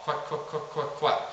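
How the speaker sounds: even string of whispered quacks. Shown as a demonstration. Coach showing the feed call again, whispering. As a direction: keep each quack hushed and short. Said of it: "me just whispering the word quack".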